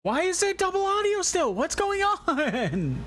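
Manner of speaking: high, slow disbelief; what on earth is happening; baffled. Real, not acted. Confused by double audio.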